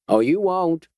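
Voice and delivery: a lightly voiced Georgia backwoodsman toying with the stranger; Georgia twang. A narrator's reading. Vigilante warns the doctor off in a Georgia twang. Georgia man holds his voice.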